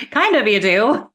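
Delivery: real amused laugh. Real, not acted. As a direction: tease back while laughing. Laughing reply to joke.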